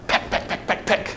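whispered pecking sounds; hen pecking. Retold or imitated. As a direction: peck peck like a hen, under the breath. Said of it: "going after people like a hen".

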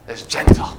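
breathy whisper on "is gentle". Shown as a demonstration. Preacher tells the congregation God is gentle, whispering. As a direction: hush the voice right down to a whisper.

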